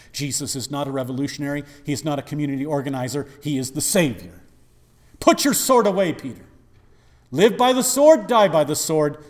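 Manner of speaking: preaching at full force. Acted. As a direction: preach it like a revival climax. Preaching Jesus' rebuke.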